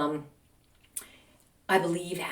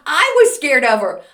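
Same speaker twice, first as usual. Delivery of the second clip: high, loud, incredulous; exclaimed confession; 7 semitones higher, 9 dB louder than usual. Real, not acted. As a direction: blurt it out loudly in disbelief. Exclaimed punchline.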